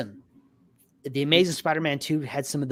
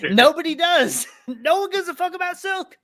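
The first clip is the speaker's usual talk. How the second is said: laughing agreement; 5 dB louder than usual. Real, not acted. Co-host echoes the Silk joke, laughing in a high voice. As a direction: crack up while loudly agreeing.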